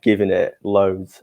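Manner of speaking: ordinary delivery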